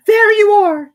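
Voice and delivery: very high, loud vindicated cry; triumphant squeak. A narrator's reading. Piglet to Kanga, vindicated. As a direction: crow that you were right.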